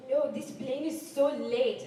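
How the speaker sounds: slow mock French-accented English. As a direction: imitate the French lady, drawn out. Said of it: "she translated later".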